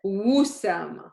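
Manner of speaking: Wusama gliding in on a slow W; no glottal catch, ease into Wusama with a W; without the hamza. Shown as a demonstration. Teacher showing the word without hamza, W glide onset. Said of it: "if it would start without the hamza".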